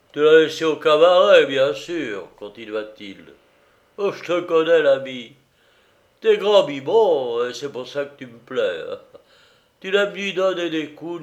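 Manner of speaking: loud, tipsy, rambling sacristan scolding his friend; a sozzled old man mock-lecturing a pal, loud and loose; drunken sacristan.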